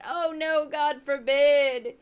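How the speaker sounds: drawn-out sarcastic dismay; exaggerated fake alarm; sarcastic mock horror. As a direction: feign horror, stretching out the words. Sarcastic mock horror at taxing corporations.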